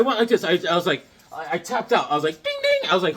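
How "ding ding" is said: very high bell imitation; ding it like a boxing bell. Tapping out of the room, high bell ding.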